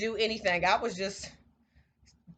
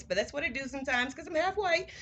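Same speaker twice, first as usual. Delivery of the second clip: ordinary talk, nothing marked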